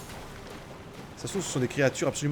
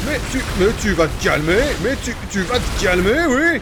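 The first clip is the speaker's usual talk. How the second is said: scolding the monster; 4 semitones higher, 5 dB louder than usual. Real, not acted.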